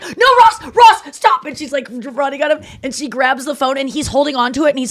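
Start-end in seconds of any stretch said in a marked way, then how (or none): 0.0-1.5 high, loud frantic stop; hammed-up desperate scream; frantic pleading. Rachel grabbing the phone from Ross, frantic and loud.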